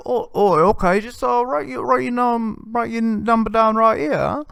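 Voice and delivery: high put-on Australian accent, eager; Australian accent impression. Comic voicing the skit guy getting the number, in a high Australian accent. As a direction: hand her the pad in a goofy Australian accent. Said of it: "this is full Australian".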